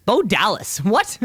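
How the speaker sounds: startled double take; surprised. Real, not acted. Surprised repeat of name just read.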